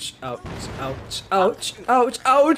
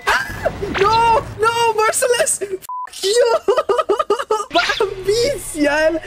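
panicked screaming; 14 semitones higher, much slower than usual. Real, not acted. Caught by killer, screaming.

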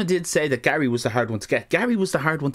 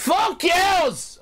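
high, yelled retort; hammed-up defiant yell; defiant shout. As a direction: shout it out across a busy arcade. Retold outburst in the arcade.

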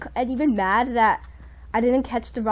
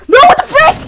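very high, loud cry of protest; shocked yell; 14 dB louder than usual. Real, not acted. Player getting knocked out near the win, shocked yell. Player being killed in game.

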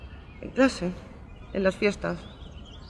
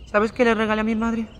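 higher-pitched, pressing question; raised rhetorical question; 4 semitones higher, 3 dB louder than usual. Acted. Daughter confessing about her mother's gift, raised question. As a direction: put the question out high and firm.

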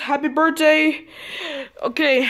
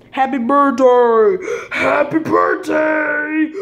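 slow shouted greeting; slower than usual. Pretend. Shouting after the Halloween scare.

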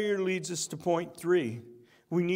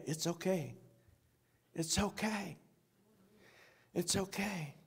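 soft, repeated comfort; Jesus hushing a repentant believer; reassuring; 10 dB quieter than usual. Acted. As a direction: gently soothe someone who is sorry. Jesus reassuring the sorry heart.

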